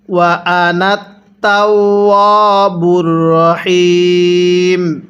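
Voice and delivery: ওয়া আনা short, তাওয়াবুর রহিম slowly chanted; slow recitation. Shown as a demonstration. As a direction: recite without stretching আনা. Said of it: "কিভাবে পড়তে হবে?".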